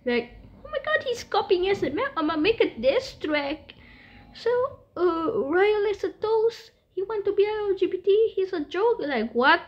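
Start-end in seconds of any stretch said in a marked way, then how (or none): 0.6-9.0 high, squeaky mocking imitation; high-pitched mock tattling; whiny hater. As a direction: mimic a petty hater in a high whine. Mimics hater planning a diss track.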